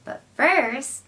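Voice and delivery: usual speaking voice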